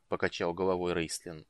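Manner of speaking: usual speaking voice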